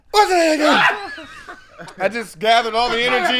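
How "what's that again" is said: laughing shout. Pretend. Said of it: "Make that noise again".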